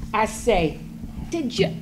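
normal talking voice

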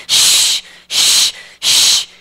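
long, strong hissing shh; repeated rushing shh bursts; imitated water spray. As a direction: hiss like water spraying hard. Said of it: "It was the water".

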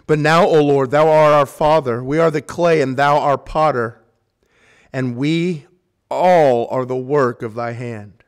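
loud, slowing recitation of the verse; ringing Bible reading; loud scripture reading. Acted. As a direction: proclaim the verse, slowing on the last line. Reads scripture loudly, slowing.

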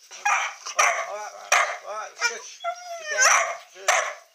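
shrill exasperated shouting; yelling over the dog. Real, not acted.

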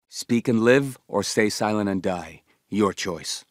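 low-pitched, controlled menace; low, deliberate ultimatum; menacing threat. Acted. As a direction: threaten a captive coldly. Threatening a prisoner.

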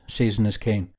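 plain reading voice

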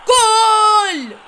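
loud, high goal yell; goal shout. Real, not acted. Kid scoring in a football game, yelling goal. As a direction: roar the goal in triumph.

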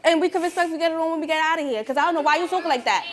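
loud, high furious rant; angry yelling. Real, not acted. Teen furiously yells at audience mother. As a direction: tear into the heckler.

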